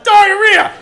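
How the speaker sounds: very high, loud mock yell of one word; blurted answer. Coworker answers the agent from twenty feet away, loud and high. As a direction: bellow it across the room. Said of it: "she was like".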